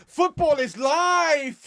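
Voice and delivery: high, slow, laughing roar; rallying shout. Pretend. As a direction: belt it out like a terrace chant. Reads comment as rallying cry.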